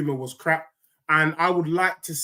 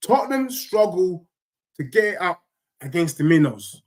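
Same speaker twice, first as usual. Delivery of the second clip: ordinary talk, nothing marked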